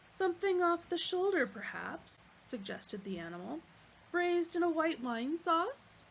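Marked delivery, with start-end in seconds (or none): 0.1-2.3 the polite meat-animal offering itself, higher; eager butcher's-block waiter voice; bovine waiter. Acted. Dish of the day offers itself, eager waiter. 4.1-6.0 the polite meat-animal offering itself, higher; eager butcher's-block waiter voice; bovine waiter. Acted. Dish of the day offers itself, eager waiter.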